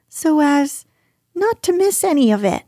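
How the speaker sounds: high, quick, eager little voice; small creature piping up in a hurry; Piglet. Acted. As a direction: chime in fast and high-pitched.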